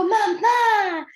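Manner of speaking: high, loud delighted mamá; a toddler's happy shout of mamá, pitched high; joyful child. Retold or imitated. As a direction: greet mom, thrilled she's here. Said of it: "le da mucho gusto".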